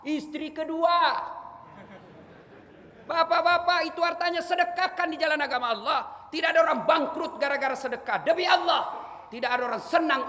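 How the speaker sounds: high, loud, driving sermon; fiery preaching. Real, not acted. Preacher urges the congregation to give charity, fiery and loud. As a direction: preach it with full force.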